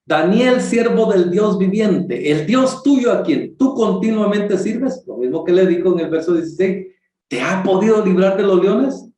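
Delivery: king calling into the lions' den, sad and hopeful; sad pleading call. Retold or imitated. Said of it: "le dice con voz triste".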